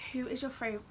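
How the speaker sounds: ordinary delivery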